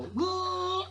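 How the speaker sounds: high, stretched whoa of wonder; mock amazed whoa. Uncle marvels at the drill making fire, high whoa. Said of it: "and go".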